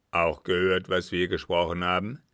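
loud interrogating captain with mock courtesy; menacing captain. A narrator's reading. Captain asks what the girl has seen, menacing. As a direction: press her loudly, politely threatening.

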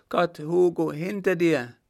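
high, loud, panicked scream; shrill cry of terror. Acted. Margot warns Hugo from the carriage, shrill terrified scream. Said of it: "ein schriller Angstschrei".

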